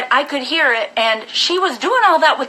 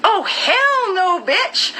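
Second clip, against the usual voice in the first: slow, higher mock of her defiant comeback; sassy retort; 10 semitones higher, much slower than usual. Girlfriend defiantly refusing to leave, sassy and slow. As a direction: stretch it out slow and high with attitude. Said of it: "and she was like".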